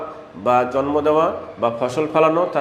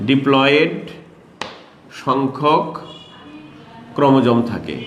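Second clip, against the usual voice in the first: slow dictation. Shown as a demonstration.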